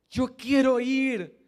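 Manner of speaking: tearful insistence remembered from the pew. Retold or imitated. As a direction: plead harder, slowing down. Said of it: "diciendo, Señor".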